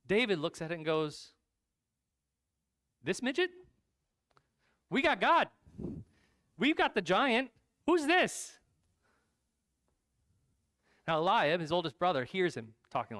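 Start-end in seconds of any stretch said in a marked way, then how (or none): 3.0-8.6 high, loud incredulous challenge; cocky disbelief. Acted. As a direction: scoff at the giant, pitch shooting up. David scoffs at the giant.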